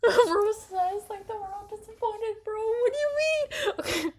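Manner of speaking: high-pitched squeal through real laughter; laughing protest. Real, not acted. Streamer reacting to a disappointed viewer's comment, laughing squeal. Cracking up at being teased.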